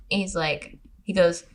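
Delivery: usual speaking voice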